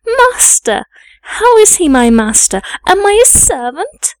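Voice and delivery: put-on high-pitched, incredulous child's protest; indignant retort. Young girl challenges the maid who called him her master, indignant. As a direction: throw the question back, voice leaping up.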